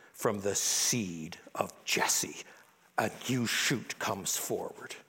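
slow, breathy whisper; whispered promise. Acted. Reading the promise of the new shoot in a slow whisper. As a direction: hush it like a precious secret.

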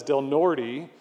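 "Norte" ending in an English "tee"; "Norte" anglicized, final e sounded "ee"; gringo pronunciation. Shown as a demonstration. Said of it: "saying it like gringos".

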